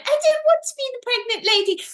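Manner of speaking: put-on high, quick child's protest; put-on high voice, rushed objection; protesting little boy. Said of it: "He went".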